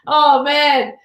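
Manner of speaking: ordinary delivery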